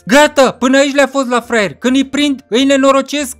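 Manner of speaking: high, loud acted rant; worked-up friend shouting; yelling threats. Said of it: "cum țipa prin părculeț".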